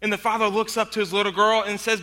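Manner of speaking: usual speaking voice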